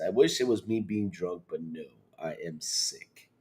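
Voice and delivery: usual speaking voice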